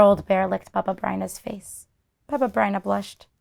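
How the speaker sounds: usual speaking voice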